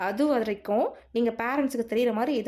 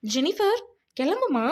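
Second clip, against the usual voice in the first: high-pitched summons to a student; calling out; 6 semitones higher, 4 dB louder, slower than usual. Acted. Teacher sends Jennifer off to her mother, calling out. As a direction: call the name out, pitch lifted.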